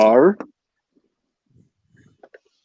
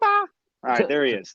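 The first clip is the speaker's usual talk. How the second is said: usual speaking voice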